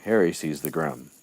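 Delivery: normal talking voice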